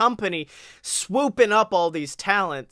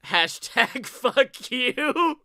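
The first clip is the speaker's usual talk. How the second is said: laughing slow high read; mock hashtag read; 5 semitones higher, much slower than usual. Reading his profile out, laughing mockingly. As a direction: drawl it out through a laugh.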